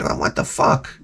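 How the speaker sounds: whispered under the breath. Retold or imitated. Owner reacting to the crashing dog, whispered. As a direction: hiss it softly, stunned. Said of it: "I said".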